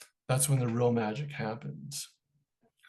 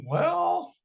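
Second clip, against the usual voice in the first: a high, drawn-out "Well"; hesitant well. Retold or imitated. Mark stalling before admitting he has the tapes, high and hesitant. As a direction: stall with a much higher "Well".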